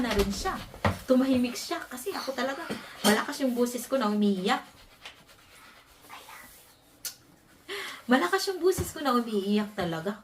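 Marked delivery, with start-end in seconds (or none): 6.1-6.6 whispered aside, no voice at all. Real, not acted. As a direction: breathe it out in a whisper. (pabulong na sinabi)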